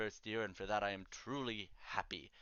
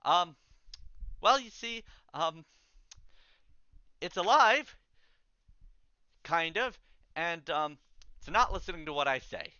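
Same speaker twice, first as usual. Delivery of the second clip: higher, louder, halting and anxious; nervous confession; 6 semitones higher, 7 dB louder than usual. Acted. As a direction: stammer it out like a scared student caught out. Draco confesses the spell failed.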